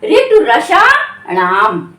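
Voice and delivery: ऋतु रसाणाम drawn out slowly and clearly; teacher recites the sutra slowly; slow sutra recitation. Shown as a demonstration. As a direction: chant the sutra slowly for students. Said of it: "क्या?".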